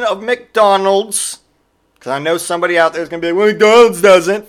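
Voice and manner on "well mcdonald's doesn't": loud, high, drawn-out mock objection; mocking objector. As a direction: mimic a nitpicker, slow and much louder. Mimicking an imagined nitpicking objector.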